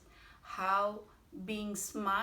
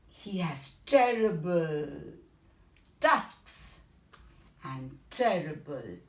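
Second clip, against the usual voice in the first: scary description; 3 semitones lower, 6 dB louder than usual. Acted. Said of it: "said the little mouse".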